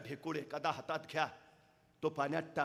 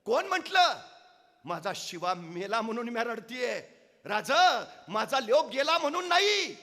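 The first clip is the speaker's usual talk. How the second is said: high, loud defiant lament; mother wishing she had more sons to give; fierce proud mother; 5 semitones higher, 8 dB louder than usual. Acted. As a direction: fire back at the king with pride. तशी ताडकन ... म्हणाली.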